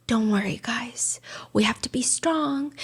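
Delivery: hushed, slowing mock reassurance; soft, near-whispered composure; so composed. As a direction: imitate someone calmly steadying the family. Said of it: "sometimes he's so composed".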